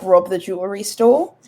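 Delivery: usual speaking voice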